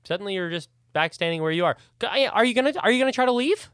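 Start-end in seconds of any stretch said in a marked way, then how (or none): 2.0-3.7 high, eager snake creature pestering. Acted. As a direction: pitch it up as a pushy little snake.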